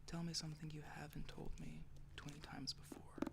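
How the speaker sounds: plain reading voice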